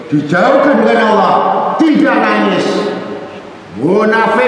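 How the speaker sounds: high, impassioned sermon cry; a preacher thundering against hypocrites, in a high, fervent voice; fiery preaching. Acted. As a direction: rebuke the flock with rising fervor. Preacher rebukes the congregation.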